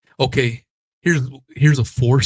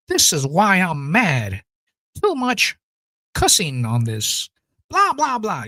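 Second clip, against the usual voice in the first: mock whiny Karen; 7 semitones higher than usual.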